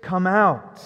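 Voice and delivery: calling out loudly into a tomb; commanding shout of a name. Acted. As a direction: summon him out with a big voice. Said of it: "called out in a loud voice".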